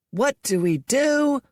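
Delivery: piping chant leader; high squeaky call. A narrator's reading. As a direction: squeak the rally question. Sun leads a chant.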